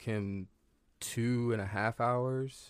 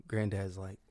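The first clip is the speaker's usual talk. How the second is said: ordinary delivery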